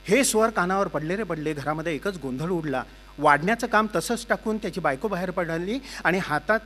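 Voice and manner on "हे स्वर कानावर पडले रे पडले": ordinary delivery, nothing marked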